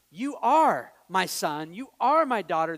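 loud, drawn-out stress on "are"; forceful parental affirmation, "are" punched loudly; emphatic affirmation. Retold or imitated. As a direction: declare it, leaning hard on "are". Parent affirming children, against threat.

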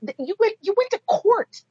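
ordinary talk, nothing marked

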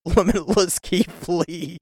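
giggling through the name; can't hold the laughter in; laughing. Real, not acted. Listing the ladder match, giggling through it. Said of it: "I can't stop laughing".

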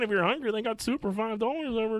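high-pitched fussy mother impression; mimicking a mom. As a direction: play a chatty mom pushing a soup deal. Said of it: "something like my mom would like".

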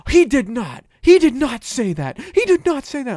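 high, loud, emphatic denial; mimicking indignant caller. Imitating a woman arguing on the phone, indignant. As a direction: push the pitch up in mock outrage.

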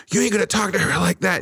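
high, hammed-up tough-guy warning; mock tough dad. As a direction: imitate a dad getting tough. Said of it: "He's just like".

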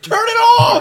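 loud, high yell to turn it off; shouting. Pretend. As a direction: yell it across the room. Yelling at prayer channel.

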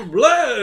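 Jerry fleeing the checkpoint with a hammed-up scream; mock panicked scream. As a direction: bolt out the door with a yelp. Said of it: "he's like".

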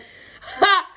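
loud, high laugh; helpless giggle. Real, not acted. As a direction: let a sharp giggle escape. Cracks up again.

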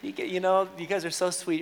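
ordinary talk, nothing marked

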